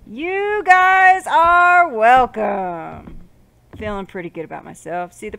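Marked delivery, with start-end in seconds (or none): 0.1-3.3 very slow, high, loud boast; showy boast. Pretend. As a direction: stretch it out like a grand proclamation. Mock-proud boast to viewers.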